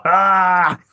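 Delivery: drawn-out hammed-up "ah" in German-accent character; throaty comic yell capping the line; mock action-hero yell. Caps his mock German robber line.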